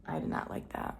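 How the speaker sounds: soft unvoiced whisper. Real, not acted. Telling viewers she disliked counseling, whispered. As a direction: drop to a breath of a whisper.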